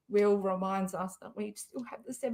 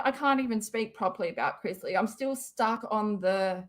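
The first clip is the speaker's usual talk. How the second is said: ordinary delivery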